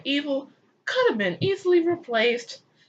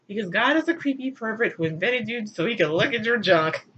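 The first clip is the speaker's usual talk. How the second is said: ordinary talk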